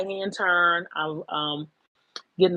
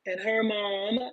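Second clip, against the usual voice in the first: usual speaking voice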